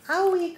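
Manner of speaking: squealed sympathetic ouch; high pained owie. Real, not acted. Teacher reacting to truck on fire, loud high owie.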